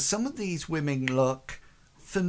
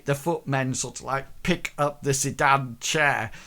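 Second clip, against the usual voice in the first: ordinary talk, nothing marked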